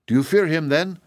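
mildly worried inquiry; anxious. A narrator's reading. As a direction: ask with a touch of anxiety. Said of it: "asked the princess anxiously".

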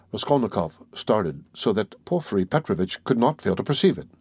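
usual speaking voice

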